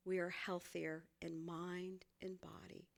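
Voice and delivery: breathy whisper for emphasis. Real, not acted. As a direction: lean in and whisper it. Soft point to the congregation.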